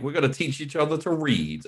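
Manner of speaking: high, naive mock-eager voice; high-pitched hammed-up enthusiasm; mock eagerness. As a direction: imitate keen simpletons, pitched well up. Voices eager lowly cult members.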